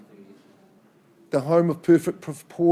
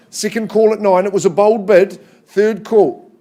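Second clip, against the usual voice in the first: loud, raised auction call; auctioneer calling; 3 semitones higher, 5 dB louder than usual. Acted. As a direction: push the bidding, loud and high. Auctioneer drives bids up.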